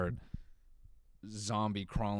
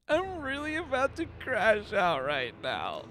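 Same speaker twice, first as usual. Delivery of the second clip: high, wailing mock meltdown, much louder; crying college student; 14 semitones higher, 6 dB louder, slower than usual. Mocking a crying student upset about her roommate, high wailing whine. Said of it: "a crying college student going".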